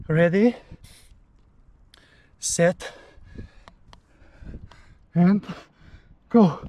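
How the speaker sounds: loud rising ready set go; excited countdown. Real, not acted. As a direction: psych yourself up and shout it. Counting down before full dip.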